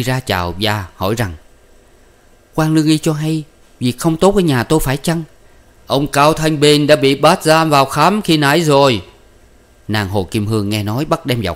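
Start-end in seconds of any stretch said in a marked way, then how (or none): 2.5-5.2 lightly voiced woman, pitched higher; worried wife's voice. A narrator's reading. Wife questions the alarmed officer, worried woman's voice. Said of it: "hơ hãi như vậy thì sanh nghi". 5.9-9.0 raised, louder, urgent report; alarmed messenger. A narrator's reading. Officer brings news of husband's arrest, alarmed messenger voice. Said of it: "hơ hãi chạy vào".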